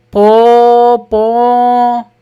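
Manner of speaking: very slow, long, loud po po; a sustained booming conch call; conch blast imitation. Shown as a demonstration. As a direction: blow it like a conch shell. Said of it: "शंख की ध्वनि कैसी होती है?".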